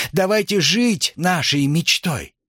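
high, slow slogan cry. A narrator's reading. Nicole finishing her slogan to the student audience, crying out. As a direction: proclaim it, stretching the words. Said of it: "выкрикнула Николь".

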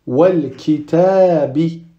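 slow wal kitabi, L sounded; lam pronounced. Shown as a demonstration. Teacher reading the second example, lam pronounced. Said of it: "on prononce le lam qui le précède".

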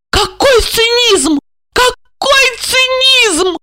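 slow, very high hysterical cry; outraged exclamation. A narrator's reading. Wife appalled by husband's cynicism, outraged exclamation. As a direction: draw out the outrage in high pitch.

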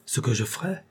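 rising, eager disbelief; delirious exaltation. Acted. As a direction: seize on her words with wild hope. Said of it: "s'écria André avec une exaltation délirante".

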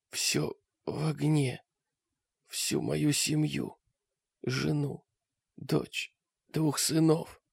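faint slow dying rasp; wounded soldier gasping. A narrator's reading. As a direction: gasp it out with last breath. Dying man's words.

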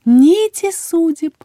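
high, loud, slow lilt; enraptured singsong. A narrator's reading. Healer keeps repeating herself, enraptured singsong. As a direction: stretch the words in dreamy rapture. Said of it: "продолжала повторять та".

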